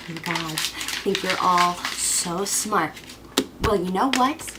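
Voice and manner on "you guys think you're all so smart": low, slow, quiet scorn; scornful. Acted. Cassandra to the gods who tricked her, low scorn. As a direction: drag it out with quiet contempt. Wronged seer turns on the gods.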